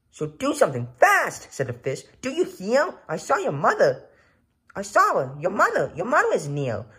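high and loud frantic urging; a fish in a flap hurrying the children; panicked fish. Acted. Fish in the pot urging the boy to act, frantic.

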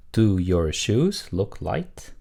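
slow, word-by-word model question; model reading. Shown as a demonstration. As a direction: read it slowly for learners to repeat. Teacher models answer for repetition. (천천히 또박또박 읽는 시범)

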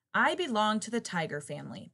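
ordinary delivery, nothing marked

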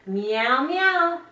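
meowing, the second one high; a playful kitty meow; mock cat meow. To the child, a cat riddle clue, playful high meow. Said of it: "animal riddles that you might like to do with me".

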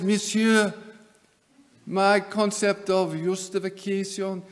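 mock Calvin in a bad French accent; hammed-up French accent, deliberate phrasing; terrible French accent. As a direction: put on a badly faked French accent. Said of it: "I'm sorry, a terrible French accent".

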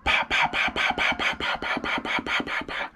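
a mouth-made volley of shots that trails off; imitated gunfire burst. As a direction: rattle off the gunshots with your lips, dying away. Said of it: "And so then it went".

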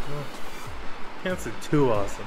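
ordinary talk

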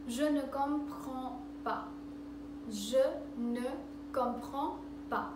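je ne comprends pas, each word spaced out; slow learner drill. Shown as a demonstration. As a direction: break je ne comprends pas into separate slow words.

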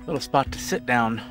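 normal talking voice

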